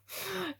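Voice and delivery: short high giggle. Real, not acted. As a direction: let a high laugh slip. Laughing at own joke.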